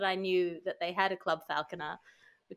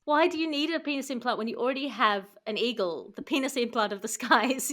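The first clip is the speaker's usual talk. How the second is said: usual speaking voice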